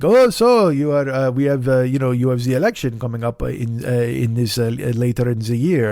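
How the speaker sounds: hesitant mock French accent; halting English in a hammed-up French accent; tortured French accent. French passenger asking driver about election, tortured French accent. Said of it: "stop the tortured French accent now".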